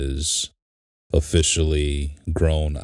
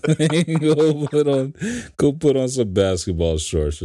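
laughing while scolding; 11 semitones higher than usual. Retold or imitated. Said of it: "and I'm like".

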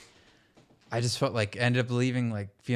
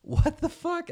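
retold baffled dad; slower than usual. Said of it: "the guy's just like".

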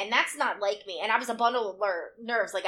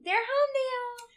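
squeaky mock voice, much higher; 13 semitones higher than usual. Friend teasing about the boys in a squeaky voice. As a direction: tease in a tiny high voice.

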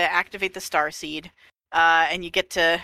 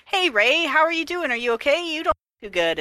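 cheerful AI voice; 8 semitones higher than usual. Retold or imitated. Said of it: "she's like".

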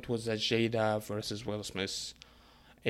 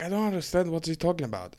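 higher, fast and a bit louder; baffled protest; 6 semitones higher, 4 dB louder, faster than usual. Retold or imitated. Telling friends he's confused, fast and baffled. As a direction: rush it out, genuinely lost.

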